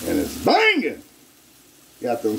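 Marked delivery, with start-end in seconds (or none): 0.1-1.0 high, sung-out catchphrase; catchphrase hype. Real, not acted. Cook selling his dish to viewers, high and sung out. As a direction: belt the catchphrase like a hype man.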